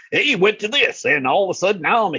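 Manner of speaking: quick high hillbilly twang, Southern hillbilly; hillbilly accent. Retold or imitated. Haunt actor recalling his first-year character, hillbilly accent. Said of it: "I fell into a hillbilly accent like that".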